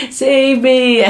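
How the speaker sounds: drawn-out pretend cry for rescue; mocking. Wrestler lying in the ring, mocking his rival. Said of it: "they started mocking, uh, Eddie".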